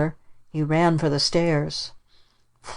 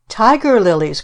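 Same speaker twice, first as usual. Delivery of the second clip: louder, eager little cry; child's cry; 5 semitones higher, 5 dB louder than usual. Acted. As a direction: call it out like an excited boy. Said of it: "cried Dickie".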